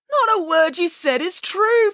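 high, slow, aggrieved protest; exasperated news director. Acted. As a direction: stretch out each word in outrage. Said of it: "protested the news director".

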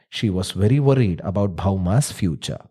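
usual speaking voice

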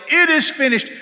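high and loud triumphant yell; shouted proclamation. Retold or imitated. Runner relaying the news, loud triumphant shout. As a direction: ring it out down the line. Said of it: "the next one would yell".

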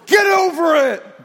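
mock harsh shout. Pastor pretending to be a mean father to his scared boys, a mock angry shout. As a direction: bark it like a mean dad, tongue in cheek. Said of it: "I wasn't mean to my kids".

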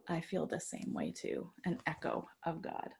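breathy whisper. Real, not acted. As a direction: hush it to nothing. Quiet closing reflection.

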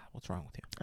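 hushed disbelief. Real, not acted. Mock disgust at untoasted Pop-Tarts.

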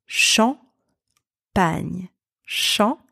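"Champagne" in two parts, "shon" lifted, pause, "pany"; syllable by syllable. Shown as a demonstration. Teacher asks learners to repeat after her, syllable by syllable. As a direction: model "Champagne" as "shon ... pany" for repeating, long gap between. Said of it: "Repeat after me".

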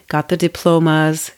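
ordinary talk, nothing marked

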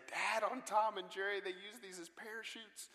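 put-on high, eager kid's voice; excited little boy. Son on a sales table tells dad about parachutes, excited high voice. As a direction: bubble over like a thrilled five-year-old. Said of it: "very excitedly he said".

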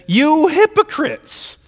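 high, slow, indignant "You hypocrites"; indignant rebuke. Retold or imitated. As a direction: stretch it out in righteous indignation. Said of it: "gets indignant himself".

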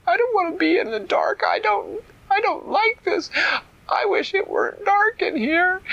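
put-on high, tearful little girl voice; crying child. A narrator's reading. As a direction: whimper it like a scared child in the dark. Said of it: "could hear Carly crying".

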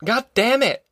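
high-pitched burst of temper; exasperated, high outburst; angry yell. A narrator's reading. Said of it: "he yelled".

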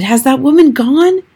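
high, loud anxious question; boy asks grandmother if the witch has gone; anxious shout. A narrator's reading. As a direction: call down, fearful. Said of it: "I shouted".

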